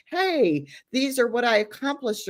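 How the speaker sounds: eager, high-pitched greeting to a therapist; looking forward to it. Acted. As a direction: play someone proud to share good news. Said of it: "look forward to it".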